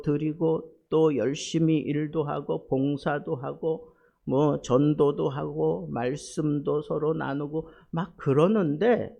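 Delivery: loud, pressing sermon delivery; fervent preaching. Acted. Pastor urging the congregation about crushed hearts, loud fervent preaching. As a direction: drive the pain home to the listeners.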